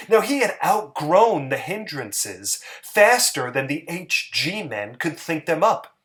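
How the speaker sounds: usual speaking voice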